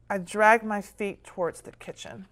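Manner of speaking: ordinary delivery, nothing marked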